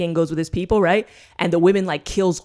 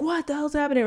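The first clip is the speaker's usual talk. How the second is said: much higher, squeaky mock panic; panicked disbelief; 11 semitones higher, faster than usual. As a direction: act out a freaked-out king. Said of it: "he's like".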